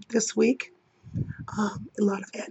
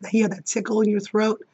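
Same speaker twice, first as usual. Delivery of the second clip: ordinary delivery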